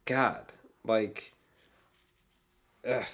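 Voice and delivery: ordinary talk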